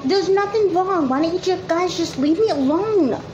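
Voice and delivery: defensive outburst. Acted. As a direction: push them away, voice flaring high. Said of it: "Christy never gets that defensive".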